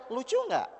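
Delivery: usual speaking voice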